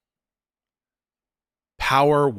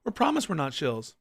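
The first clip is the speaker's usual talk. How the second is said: mocking impression; 11 semitones higher than usual.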